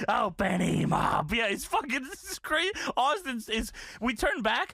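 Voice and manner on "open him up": drawn-out mock yell; hammed-up imitation of a boss egging on; Vince. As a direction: stretch it out as a slow mock holler. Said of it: "Vince is heard on the mic".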